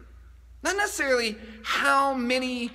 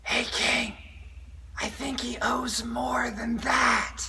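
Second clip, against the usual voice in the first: stage whisper; 5 dB quieter, slower than usual. Acted. Skit: aside whisper.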